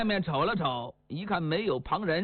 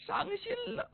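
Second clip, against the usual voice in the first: mock heartbroken; 7 dB quieter than usual. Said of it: "我呀，伤心了".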